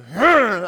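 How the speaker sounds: loud imitated heave grunt; effort grunt. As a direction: grunt like lifting dead weight. Cop lifting the man.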